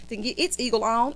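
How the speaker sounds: ordinary talk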